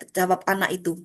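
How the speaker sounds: ordinary delivery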